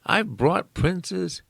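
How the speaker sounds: near shout. Retold or imitated. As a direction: call out to a deaf old mother. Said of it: "Truman said in a near shout".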